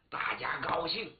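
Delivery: ordinary delivery, nothing marked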